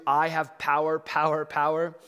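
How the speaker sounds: loud, puffed-up parody of Pilate; mock his pompous claim to power; mocking boast. Preacher mocking Pilate's boast to the congregation, loud mock swagger. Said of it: "the audacity and the foolishness".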